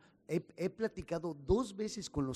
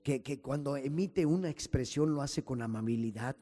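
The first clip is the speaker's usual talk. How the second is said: louder, rising pastor's prayer building in force; fervent prayer; 5 dB louder than usual. Acted. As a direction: pray aloud to the Father with growing fervour. Pastor leads closing prayer.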